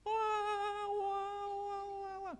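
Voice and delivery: high mock wails that fade off; mock childlike wailing. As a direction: wail like a small child, dying away. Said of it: "berteriak-teriak seperti anak kecil". (meniru tangisan anak kecil yang melengking lalu melemah)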